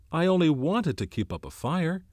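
high-pitched, urgent self-defence. A narrator's reading. Ralph explains himself to the twins, urgent and pleading. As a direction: press the point urgently, pitch lifted. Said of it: "whispered Ralph urgently".

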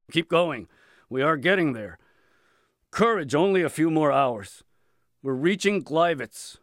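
loud, high urging shout; shouting officer. A narrator's reading. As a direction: call out to drive marchers on.

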